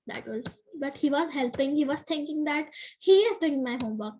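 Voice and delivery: ordinary delivery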